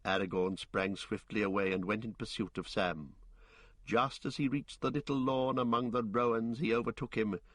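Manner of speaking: plain reading voice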